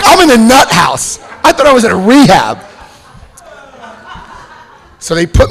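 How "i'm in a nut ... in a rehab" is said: higher, louder comic protest; mock outrage. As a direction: exclaim it in exaggerated dismay. Shocked realisation about the place.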